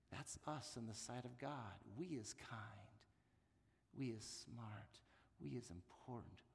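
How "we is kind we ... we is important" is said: tender nanny reassurance. Shown as a demonstration. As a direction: gently tell the child what she is worth. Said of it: "applies the line to us".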